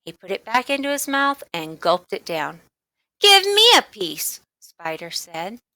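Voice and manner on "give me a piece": demand. A narrator's reading. Bully demanding.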